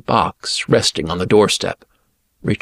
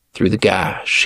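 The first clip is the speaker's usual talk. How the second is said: plain reading voice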